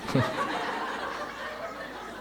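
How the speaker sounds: breathy high laughing burst; real amused laugh. Real, not acted. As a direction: let a big laugh break out. Laughs at own joke.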